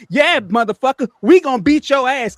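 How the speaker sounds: loud, high retold shout from behind; imitating Joy yelling. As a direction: bellow it like backup arriving. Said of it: "'cause this is Joy".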